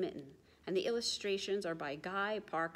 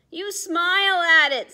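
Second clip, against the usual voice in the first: high, loud, beaming; grinning little penguin; 10 semitones higher, 13 dB louder than usual. Acted. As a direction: say it with a big grin.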